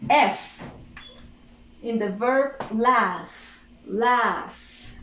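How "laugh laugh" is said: "laugh" drawn out into a long F; voiceless final F. Shown as a demonstration. Teacher isolating the verb's last sound, voiceless final F. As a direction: hold the breathy F that ends "laugh". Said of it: "F is voiceless".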